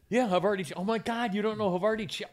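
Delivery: high, amazed outburst about the cheese; can't believe they've never heard of it; shocked disbelief. Real, not acted. Disbelief someone doesn't know Havarti.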